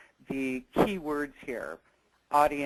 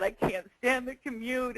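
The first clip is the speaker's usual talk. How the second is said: retold blubbering; 8 semitones higher than usual. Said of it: "and I was blubbering".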